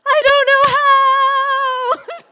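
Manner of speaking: high, very loud tearful wail; hammed-up teenage sobbing yell; wailing scream. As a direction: bawl it back, stretching each word. Retold crying teen screaming back.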